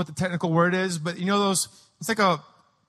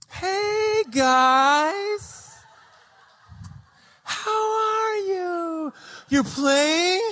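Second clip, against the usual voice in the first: high, drawn-out sing-song greeting; strained sweetness hiding panic; feigned cheerfulness; 9 semitones higher, much slower than usual. Retold or imitated. Mom coaxing children from the window, feigned cheerfulness.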